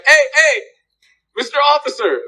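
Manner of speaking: high, playful mock hail; calling out. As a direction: act out hailing someone cheekily. Acts out calling over a cop.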